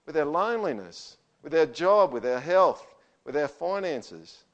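rising, emphatic pulpit delivery; fervent preaching. Acted. Pastor urging the congregation to seek grace, fervent. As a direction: plead for grace with growing force.

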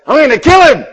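put-on high, loud little-boy battle cry; fierce toddler. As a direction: yell it like a tiny hunter charging off. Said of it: "he goes".

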